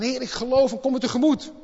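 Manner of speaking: loud, high cry of faith. Acted. Father crying out to Jesus that he believes, loud and high. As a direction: shout it to the Lord with all your heart. Father's plea voiced again.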